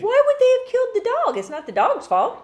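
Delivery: high, loud, indignant disbelief; shocked protest. Real, not acted. Co-host defending the dog from blame, shocked protest. Protests the dog's supposed death.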